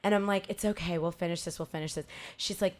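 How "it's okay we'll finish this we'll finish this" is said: soft soothing voice, sinking lower; calm a shaken friend, fading down; retold gentle murmur of comfort; hushed reassurance.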